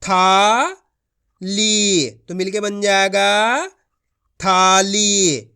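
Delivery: slow, careful sounding out of each syllable; demonstration reading. Shown as a demonstration. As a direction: show the kids how to blend. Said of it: "चलिए देखिए".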